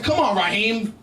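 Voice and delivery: ordinary delivery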